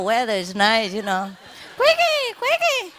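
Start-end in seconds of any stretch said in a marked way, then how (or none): 1.8-2.9 high sing-song call to a pet; high ringing call; calling the bird. Real, not acted. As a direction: call her home from across the yard.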